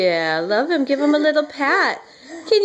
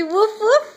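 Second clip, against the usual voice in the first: higher woof woof rising like a question; coaxing dog bark; 7 semitones higher than usual. Shown as a demonstration. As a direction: invite the baby to copy the bark. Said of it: "Can you say".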